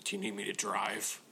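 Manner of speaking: mock gravelly tough-guy mumble. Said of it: "weird kind of gravelly voice".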